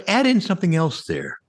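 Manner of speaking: ordinary delivery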